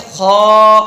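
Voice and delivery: خو loud, held long with a heavy rounded vowel. Shown as a demonstration. Teacher models istila for the class, heavy kh. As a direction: lift the tongue root and fill out خو. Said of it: "زبان کی جڑ کو اوپر اٹھائیں گے".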